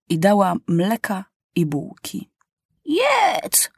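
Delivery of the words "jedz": put-on old woman. A narrator's reading. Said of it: "mówi".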